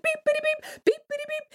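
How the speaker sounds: very high excited beeping; excited squeak. Acted. Butterball bursts out, high excited beeping. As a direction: squeak with eagerness.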